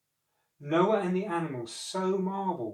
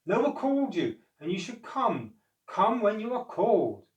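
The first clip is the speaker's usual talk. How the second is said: unicorns scolding; 6 semitones higher, 4 dB louder than usual. A narrator's reading. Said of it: "called the unicorns".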